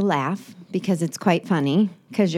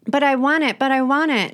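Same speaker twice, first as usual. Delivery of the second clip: whiny want; 5 semitones higher, 4 dB louder than usual. Pretend. Mocking a craving shopper.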